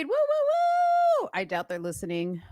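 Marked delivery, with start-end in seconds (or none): 0.0-1.3 cheering. Real, not acted. Cheering the newlyweds.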